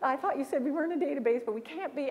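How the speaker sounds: mock-polite Canadian argument; overly polite Canadian protest. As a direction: argue while apologizing, the polite Canadian way. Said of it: "So I said".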